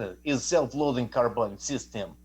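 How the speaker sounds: self-loading carbine system in a mock Russian accent, slow; mock Russian accent, slow and deliberate. Shown as a demonstration. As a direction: lay on a hammy Russian accent. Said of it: "but say with an, you know, a Russian accent".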